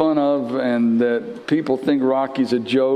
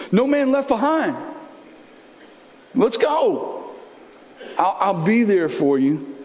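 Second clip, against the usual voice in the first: raised, driving preacher voice; fervent preaching; 7 semitones higher than usual. Acted. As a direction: preach the promise with fire. Preacher voicing Jesus' promise.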